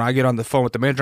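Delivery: normal talking voice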